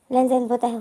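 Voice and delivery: ordinary delivery, nothing marked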